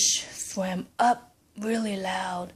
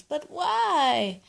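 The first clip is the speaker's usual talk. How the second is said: puzzled grandpa; 6 semitones higher than usual. Acted. Grandpa questions wife.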